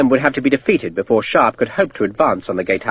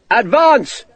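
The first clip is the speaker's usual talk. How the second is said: shouted command; 9 semitones higher than usual. A narrator's reading. Sharp ordering his men to advance, shout. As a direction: drive the line forward. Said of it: "Sharp shouted".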